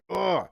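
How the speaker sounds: real grunt of frustration; frustrated groan. Real, not acted. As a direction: groan at your own blank mind. Frustrated at forgetting the word.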